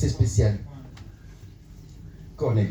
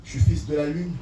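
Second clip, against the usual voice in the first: high, driving spoken verse; impassioned slam recital; 8 semitones higher than usual. Acted. Poet missing the Comoros claims his exile, impassioned slam. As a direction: declaim it with mounting intensity.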